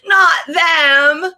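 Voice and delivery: put-on shrill child's wail, loud and high; niece yelling. Niece seeing family on Zoom, loud whiny yell. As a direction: wail it like a bratty kid. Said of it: "she just yells".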